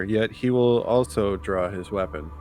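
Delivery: ordinary talk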